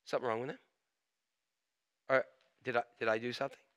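low, very soft self-questioning; hushed, halting self-doubt; worried inner voice. Acted. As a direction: think aloud under your breath, with hesitant pauses. Inner worry after being ignored.

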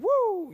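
wrestler's loud high woo; Ric Flair woo. Pretend. Preacher imitates a wrestler, loud high woo. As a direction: whoop like a showboating wrestler. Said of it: "like I'm Ric Flair".

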